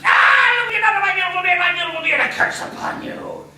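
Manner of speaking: very high loud wail then curse; cursing dwarf. Acted. Dwarf curses the girls over his beard, shouting. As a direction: howl over the lost beard.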